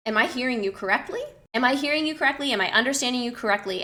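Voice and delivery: quick question with CORRECTLY lifting at the end; rising intonation. Shown as a demonstration. Repeating someone's words back as a question, rising intonation. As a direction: repeat it back briskly, raising the pitch on "correctly". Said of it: "have a rising intonation at the end".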